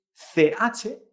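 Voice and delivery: usual speaking voice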